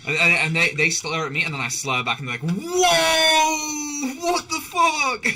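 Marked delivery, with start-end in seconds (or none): 2.5-5.2 high, loud, drawn-out what the fuck; shocked yell. Retold or imitated. Strangers startled by slurring back at them, shrieking. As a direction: shriek it like caught off guard.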